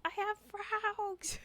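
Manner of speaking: slow, high, stretched-out excited voice; excited friend's quote. Retold or imitated. Quoting a friend's delight at the frog gift. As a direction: drag it out like a thrilled little kid.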